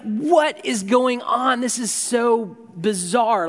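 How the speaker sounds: baffled disbelief. Retold or imitated. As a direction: stretch it out like you can't believe it. Jim baffled by Paul leaving.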